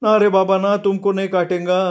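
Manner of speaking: sustained high-pitched wail; high screechy promise to a pet; high shriek. A narrator's reading. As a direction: cry out protectively, pitched right up. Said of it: "तो किंचाळला".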